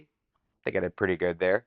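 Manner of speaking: ordinary talk, nothing marked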